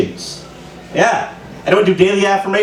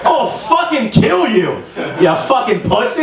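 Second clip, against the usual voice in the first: slow, higher-pitched threat; mock threat; 5 semitones higher, slower than usual. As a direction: threaten your own reflection, drawing it out. Threatens himself in the mirror.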